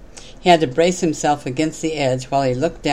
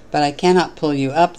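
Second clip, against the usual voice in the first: usual speaking voice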